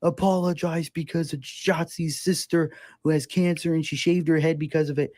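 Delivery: high, indignant mock voice of angry fans; shrill Twitter crowd demanding an apology. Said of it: "people being like".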